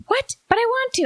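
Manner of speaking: put-on very high whiny child voice; whiny little girl. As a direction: pitch it way up like a pleading little girl. Child protesting to the mother.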